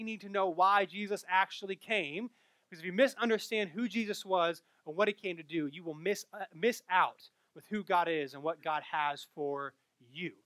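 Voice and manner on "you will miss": normal talking voice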